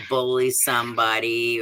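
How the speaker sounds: ordinary delivery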